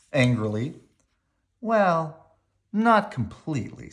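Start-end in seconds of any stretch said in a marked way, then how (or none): 1.6-3.9 a hint of higher, gentle mother; Mama partly agreeing with Brother; Mama's voice. A narrator's reading. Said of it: "said Mama".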